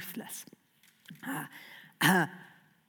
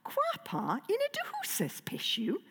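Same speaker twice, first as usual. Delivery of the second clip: dragon language; 4 semitones higher, 6 dB quieter than usual. Acted. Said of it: "common dragon phrases".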